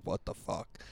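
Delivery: unvoiced retold whisper. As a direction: breathe it out in disbelief. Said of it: "I was like".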